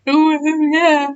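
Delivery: high, teary, laughing oh yeah; a teary, laughing yes; blubbering yes. Retold or imitated. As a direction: say yes while breaking down. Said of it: "I was just going".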